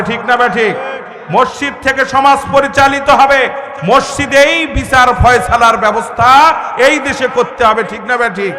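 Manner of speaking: loud, punchy mosque sermon; rally the congregation with forceful declarations; full-force preaching. Acted.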